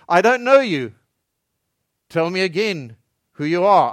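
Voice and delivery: a hint of a stern gatekeeper; stern abbot. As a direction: bar the gate like a stern abbot. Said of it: "the abbot inside would say".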